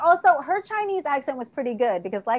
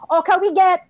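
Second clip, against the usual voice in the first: higher, louder mock Chinese accent; put-on Chinese accent; 7 semitones higher, 6 dB louder than usual. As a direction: imitate an aunt ordering in a hammed-up Chinese accent. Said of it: "what accent she was doing".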